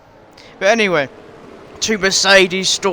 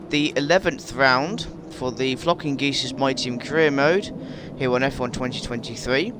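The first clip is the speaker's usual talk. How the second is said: ordinary talk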